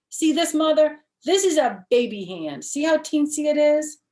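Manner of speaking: put-on high, loud, insistent little girl protesting; a kindergartner stamping her foot in words; small girl loudly insisting to her mother. Junie B shows baby hand.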